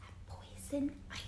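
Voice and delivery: soft whispered warning; hint at the danger in a hush; ominous whisper. A narrator's reading. Teacher whispering the twist to her class, hushed.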